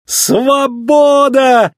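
high, long-held shout of freedom; jubilant cry. A narrator's reading. Race car speeding away from captors, jubilant cry. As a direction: cry out in triumph, stretching the vowels. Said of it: "крикнул сверкающий гоночный автомобиль".

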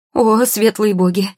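high, loud gasp of alarm; shocked outcry; startled recoil. A narrator's reading. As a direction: exclaim while shying away in fright. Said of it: "шарахнулась в сторону она".

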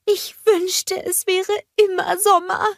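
dreamy snowman with a catch in the voice; Olaf longing. A narrator's reading. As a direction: sigh for endless summer.

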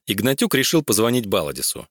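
plain reading voice

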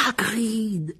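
high, drawn-out cry of relief; relieved exclamation. Acted. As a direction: call out the name, relieved and high-pitched. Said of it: "s'exclama Harry avec soulagement". (exclamation soulagée)

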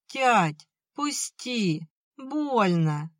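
put-on high, pleading boy's whine; thin whimper. A narrator's reading. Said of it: "тоненько проскулил Пашка". (тоненько поскуливая)

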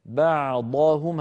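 بعضهم slow with an open emphatic ض; tajweed demo. Shown as a demonstration. Teacher isolating بعضهم to show the open ض. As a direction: say بعضهم slowly, ض heavy and open. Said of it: "هذه ضاد مفتوحة".

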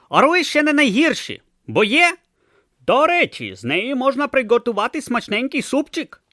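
high, loud hawker voice; street hawker Dibbler. Acted. Seller leans in to Brutha, high hawker patter. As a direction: pitch soup like a shifty street trader.

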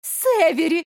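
high, loud, blushing call of his name; light up at the sight of him; delighted cry. A narrator's reading. Blonde greets the mayor she fancies, high delighted cry. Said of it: "зарделась девушка".

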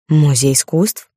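slow, sceptical query; measured, doubtful interjection; interrupting. A narrator's reading. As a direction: cut in with a drawn-out question. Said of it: "перебила леди Ребекка".

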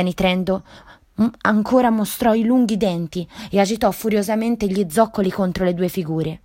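plain reading voice

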